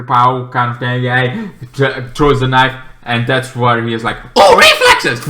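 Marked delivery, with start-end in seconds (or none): 4.3-5.2 high, loud mock yelp of surprise; hammed-up startled exclamation; mock startled hero. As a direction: yelp it like the hero caught off guard. Hero dodges thrown knife, mock cry.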